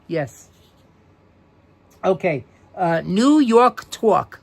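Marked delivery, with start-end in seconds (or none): 3.0-4.4 "New York Talk" stretched slowly in a broad New York accent, "tawk"; slow, loud New York drawl on "Talk" as "tawk". Shown as a demonstration. Teacher reads book topic to class in New York accent. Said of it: "That's N E W Y A W K".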